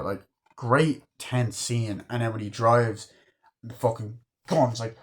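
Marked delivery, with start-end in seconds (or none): none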